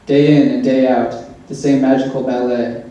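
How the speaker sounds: ordinary delivery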